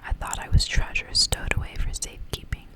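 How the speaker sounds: ordinary talk, nothing marked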